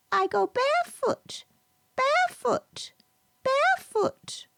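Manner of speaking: very high clucking hen voice, fading to a whisper. Acted. The hen runs off from Lucy, high clucking. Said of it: "ran into a barn clucking".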